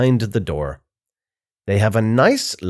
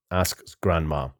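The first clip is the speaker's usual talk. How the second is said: ordinary delivery, nothing marked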